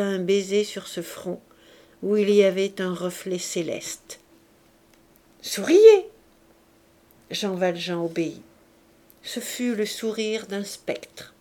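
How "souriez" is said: high, loud bright order; playful command. A narrator's reading. Cosette ordering Jean Valjean to smile, playful command. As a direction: call it out as a cheerful command.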